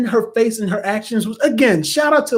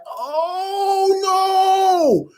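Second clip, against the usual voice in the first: much higher crying shout, held long; anguished oh no stretched out; horrified cry; 12 semitones higher than usual. Retold or imitated. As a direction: wail it out in horror. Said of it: "I said".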